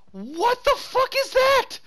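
high, slow stunned question; shocked disbelief. Real, not acted. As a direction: gasp it out in shock. Sudden confusion at the screen.

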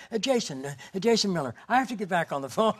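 high, rushed, half-laughing hurry; blurting his name while rushing back; hurried, breathless intro. Retold or imitated. Jason Miller at the airport rushing back to the phone, high and hurried.

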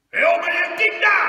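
very loud, high-pitched bellow; brusque, full-force call; gruff shout. Acted. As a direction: burst in with a rough robber's yell. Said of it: "eine barsche Stimme rief".

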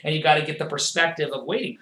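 normal talking voice

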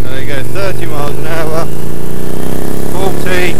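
talking into the boom mic while riding at speed; through the helmet boom mic. Real, not acted. Rider testing the boom mic on the road, talking loud over wind noise.

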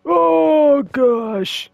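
high, loud startled cry; startled yelp. Real, not acted. Player jumps at a monster with a high, loud yelp. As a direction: yelp in sudden fright.

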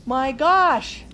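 loud astonished "my gosh"; surprised exclaim. Real, not acted. Reader to listening children, loudly amazed. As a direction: burst out in amazement.

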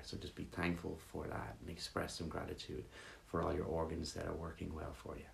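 guided meditation. Real, not acted. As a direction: guide them gently through a body scan, leaving pauses. Leads a gratitude meditation.

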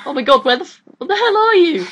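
high panicked exclaiming; scared. Real, not acted. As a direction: freak out at the monster. Scared by invisible enemy.

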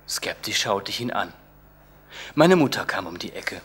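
plain reading voice

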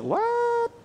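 very high, incredulous squeal of confusion; baffled outsider. Acted. Outsiders reacting to church jargon, baffled and high-pitched. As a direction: shoot the pitch way up, mock disbelief. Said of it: "they're gonna look at you like".

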